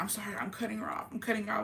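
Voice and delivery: hushed, hurried mimicked whisper; whispered apology. Friend apologizing for the drunk girl, whispered apology. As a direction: imitate a furtive sorry under the breath.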